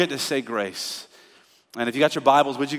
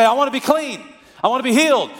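pleading; 8 semitones higher, 4 dB louder, faster than usual. Retold or imitated. Lepers pleading to be healed.

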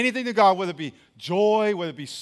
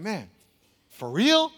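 imitated disbelief; slower than usual. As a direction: ask it like a doubting listener. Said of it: "I know some of y'all thinking".

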